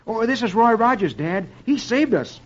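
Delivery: put-on high, eager little-boy voice; Tommy. A narrator's reading. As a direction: introduce your rescuer to Dad like a keen kid. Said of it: "Tommy introduces Hardy and Roy".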